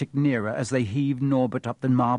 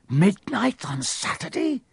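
slow, hoarse and higher, alarmed; 8 semitones higher than usual. Acted. Ron in the hospital bed, hoarse with alarm. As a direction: croak it out in dawning panic. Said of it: "he said in a hoarse voice".

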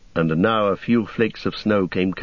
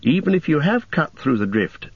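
higher, small worried hobbit; 8 semitones higher than usual. Acted. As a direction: ask as the anxious hobbit. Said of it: "said Pippin".

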